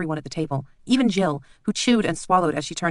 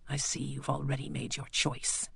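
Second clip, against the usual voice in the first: quiet menacing doctor; 10 dB quieter than usual. Acted. Bleak quietly reminds the lord of their deal.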